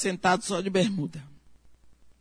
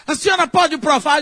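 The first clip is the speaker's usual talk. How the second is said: loud, higher demand for proof; challenging; 7 semitones higher, 5 dB louder than usual. Retold or imitated. The father demanding proof from the neighbour, loud and challenging. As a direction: throw the challenge at her.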